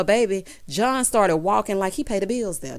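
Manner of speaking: usual speaking voice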